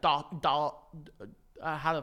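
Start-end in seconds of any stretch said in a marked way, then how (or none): 0.0-0.7 mock British da. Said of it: "so he's like".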